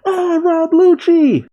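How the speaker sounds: high, drawn-out squeal of alarm; hammed-up high-pitched shriek; Chopper's alarmed cry. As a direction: cry out slowly in a tiny high voice. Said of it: "Chopper cries out".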